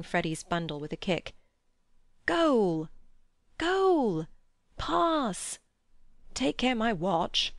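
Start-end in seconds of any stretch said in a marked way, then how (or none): 2.3-5.6 high, drawn-out sporting cries; excited calling. A narrator's reading. Players calling in a mock match. 6.3-7.5 raised, drawn-out protest; anxious cry. A narrator's reading. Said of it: "cried Mister Beebe".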